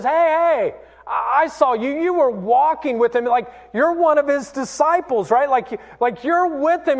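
high-pitched, pestering call-out; insistent accusing woman. Retold or imitated. Another woman confronting Peter, high and insistent. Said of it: "another woman comes up and says".

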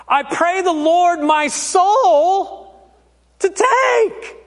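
slow recital building high to a final shout; mock-ominous child's prayer, ending in a yelp; terrorizing bedtime prayer. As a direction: draw out each phrase, climbing to an alarmed finish. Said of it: "It went like this".

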